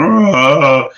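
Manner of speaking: mock cry of defeat, loud and high; frustrated cry. Drowned out on forums, giving up with a loud cry. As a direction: groan out in defeat. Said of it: "just going".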